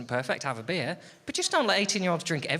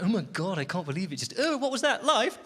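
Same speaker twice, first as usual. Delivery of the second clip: panicking friends; 5 semitones higher than usual. Retold or imitated. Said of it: "They're like".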